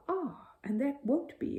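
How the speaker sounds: a mildly voiced Mrs Bird; exclaiming. A narrator's reading. Housekeeper Mrs Bird cutting in, mild exclaim. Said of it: "exclaimed Missus Bird".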